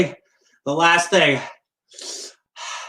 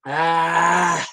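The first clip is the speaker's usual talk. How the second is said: usual speaking voice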